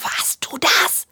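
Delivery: slow, suspicious little whisper; whispered question. Acted. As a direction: whisper it accusingly to the toy friend. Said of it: "und flüstert". (langsam und misstrauisch geflüstert)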